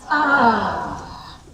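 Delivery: relaxed, open, pleasant Ah; a settled, satisfied Ah; content ah. Shown as a demonstration. Teacher demonstrating a safe, calm state, content ah. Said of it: "If you were in ventral vagal".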